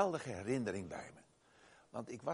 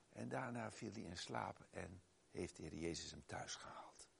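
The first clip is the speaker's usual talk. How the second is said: whispered softly, lower and much softer; hushed whisper; 6 semitones lower, 10 dB quieter than usual. Real, not acted. Preacher telling of the dying man's peaceful end, whispered. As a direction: breathe it out as a reverent whisper. Tender deathbed ending of story.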